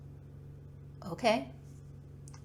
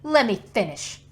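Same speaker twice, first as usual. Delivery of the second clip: much louder, hurt and indignant; angry protest; 3 semitones higher, 13 dB louder than usual. Acted. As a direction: cut her off in outrage. Heated argument over her father.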